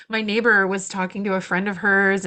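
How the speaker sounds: normal talking voice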